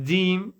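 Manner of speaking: "jim" said as "deem"; dialect D for J. Shown as a demonstration. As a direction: put a d in place of j in "jim". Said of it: "It's not jim".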